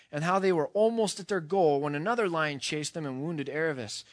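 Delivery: ordinary talk, nothing marked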